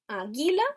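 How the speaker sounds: águila wrongly stressed on GUI; águila with the second syllable punched; stress on middle syllable. Shown as a demonstration. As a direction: say águila as a-GUI-la, rising as an option. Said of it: "¿dónde va el acento?".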